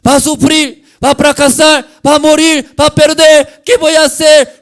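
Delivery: shouted, high-pitched cries of doom; hammed-up despair at full voice; acted panicked suffering. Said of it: "Lo sufre, sufre".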